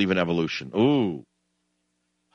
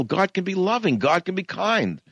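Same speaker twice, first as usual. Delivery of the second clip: ordinary delivery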